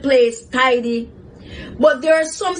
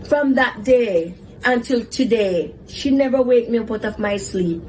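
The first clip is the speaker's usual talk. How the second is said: ordinary delivery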